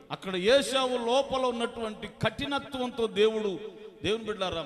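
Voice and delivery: high, loud, driving sermon; fervent preaching. Acted. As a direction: build to a ringing climax. Preaching climax. (ఉద్రేకంగా గట్టిగా ప్రసంగం)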